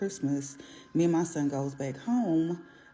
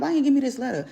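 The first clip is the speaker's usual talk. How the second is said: sharp question; 9 semitones higher, 7 dB louder, faster than usual. Retold or imitated. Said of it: "I'm like".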